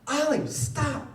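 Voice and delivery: high, loud imitation of yelling kids; kids' yell. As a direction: yell it like a fed-up sibling. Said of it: "they just yelled his name".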